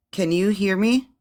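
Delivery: lagging, choppy audio; bad connection. Real, not acted. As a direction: voice breaking up online. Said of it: "You're lagging".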